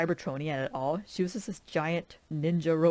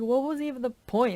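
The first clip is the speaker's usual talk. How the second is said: exasperated; 8 semitones higher, 4 dB louder than usual. Retold or imitated. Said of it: "I was like".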